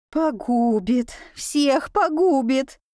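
slow, drawn-out wail of doom; fearful lament. A narrator's reading. Аська keeps up her fearful lament.

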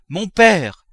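loud, high cry, falling away; pleading cry. A narrator's reading. Daughter throwing herself on her knees, crying out. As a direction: call out in desperate appeal. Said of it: "cria Eugénie".

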